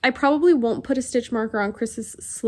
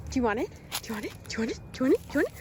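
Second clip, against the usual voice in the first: talking to a dog; 7 dB quieter, much faster than usual. Real, not acted. Teasing the dog with toy.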